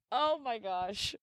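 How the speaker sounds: laughing. Real, not acted. Overcome by funny ick.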